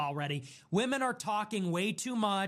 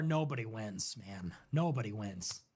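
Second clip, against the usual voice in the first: much lower, grave mock wisdom; mock solemn; 11 semitones lower, 4 dB quieter than usual. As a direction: intone it like a weary sage.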